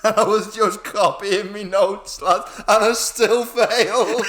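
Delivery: cracking up at own failure; wheezing laugh through the story. Real, not acted. Laughing at failing despite cheating.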